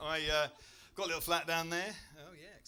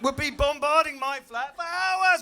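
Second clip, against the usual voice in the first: rising outrage; 8 semitones higher, 8 dB louder than usual. Real, not acted. Comic's rising outraged rant.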